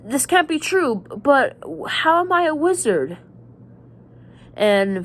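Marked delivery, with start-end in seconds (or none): 0.1-3.2 put-on raised, incredulous boy's voice; lightly voiced boy, higher and unsure; disbelieving Harry. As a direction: imitate Harry protesting in disbelief. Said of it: "And Harry's like".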